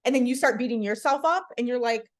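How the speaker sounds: ordinary delivery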